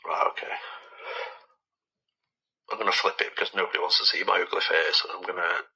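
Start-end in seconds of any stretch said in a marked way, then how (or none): 0.1-0.6 quick hushed whisper. Real, not acted. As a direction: whisper to yourself. Settling in to listen.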